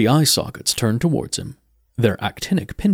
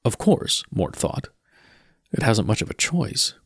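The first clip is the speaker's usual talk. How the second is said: ordinary delivery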